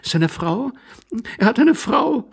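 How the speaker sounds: high lady's voice, rising in disbelief; astonished amused lady. A narrator's reading. Lady learning the intruder is married, amused astonishment. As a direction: cry out, barely mastering laughter. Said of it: "rief die Dame, die ihr Lachen".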